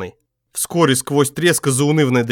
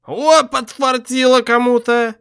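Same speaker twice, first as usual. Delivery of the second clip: slow, higher tipsy whoop; drunk exclamation; 11 semitones higher, 3 dB louder, slower than usual. A narrator's reading. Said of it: "воскликнул он пьяно".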